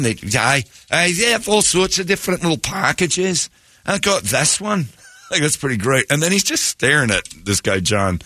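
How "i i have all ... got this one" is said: Scottish fan voice. Retold or imitated. Retelling the Scottish fan's boast.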